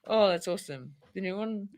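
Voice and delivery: ordinary talk, nothing marked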